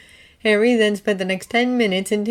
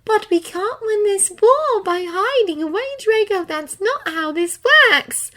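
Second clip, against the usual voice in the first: soothing; 5 dB louder, slower than usual. A narrator's reading. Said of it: "her voice was soothing".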